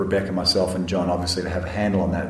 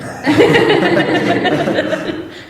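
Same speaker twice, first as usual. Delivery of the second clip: loud high laugh; big laugh; 5 dB louder than usual. Real, not acted. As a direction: burst out laughing. Laughs at own joke.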